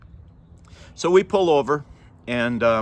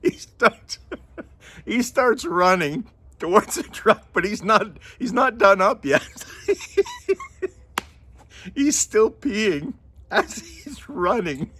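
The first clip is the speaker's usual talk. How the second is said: high voice squeezed by real laughter; real giggles breaking up the words; cracking up; 10 semitones higher, slower than usual. Real, not acted. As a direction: struggle to talk through helpless laughter. Cracking up at own story.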